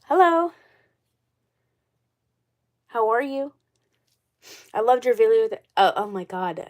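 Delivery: stuffy nose from a cold; congested voice. Real, not acted. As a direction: talk through a blocked nose. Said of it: "Sorry if I sound congested".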